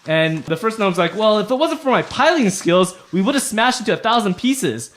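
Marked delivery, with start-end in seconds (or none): 1.2-4.9 higher, louder bickering gnome; lightly voiced indignant gnome retort; arguing gnome. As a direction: defend yourself in a mock squabble. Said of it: "arguing with each other".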